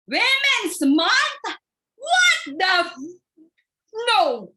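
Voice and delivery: very high, loud, slow shocked outcry; shocked shout at the memo; outraged disbelief. Acted. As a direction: scream it in horrified disbelief. March reads his memo, outraged.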